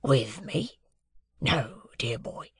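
a lightly voiced, hushed old convict; Magwitch the convict. A narrator's reading. Convict answering Pip at breakfast, lightly voiced and hushed. Convict figure in read novel.

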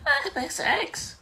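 high, thin, silly monster voice; Mister X voice. Acted. Friend giving Mister X a voice, silly high voice. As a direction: put on a squeaky villain, quite softly. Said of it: "he's gonna sound like".

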